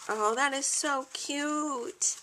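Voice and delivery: normal talking voice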